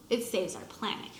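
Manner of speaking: ordinary delivery, nothing marked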